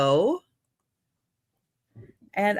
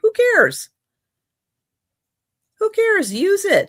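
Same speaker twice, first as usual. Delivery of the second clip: excited urging; 12 semitones higher, 5 dB louder than usual. Real, not acted. Urging buyer to use bowl.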